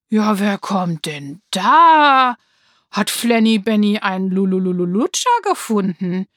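a bully grinning nastily at his victims; mocking bully. A narrator's reading. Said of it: "grinste er böse". (höhnischer Schulhof-Rüpel, laut und langsam gedehnt)

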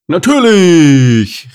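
high, very loud, slow cheer. Acted. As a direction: bellow enthusiastic approval. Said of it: "rief Robby".

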